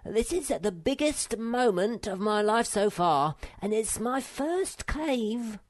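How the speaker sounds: high-voiced little creature making a solemn declaration; small animal's earnest announcement, slowing at the end. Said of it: "said Sniff solemnly".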